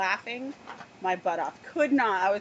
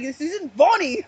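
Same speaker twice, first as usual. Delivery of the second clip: mock annoyed; 5 semitones higher, 6 dB louder than usual. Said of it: "he was so angry".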